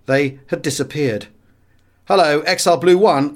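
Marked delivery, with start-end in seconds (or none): 2.1-3.3 loud, pitched-up radio hail; radio call. A narrator's reading. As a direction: call out to the section leader. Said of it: "I called to one of my section leaders".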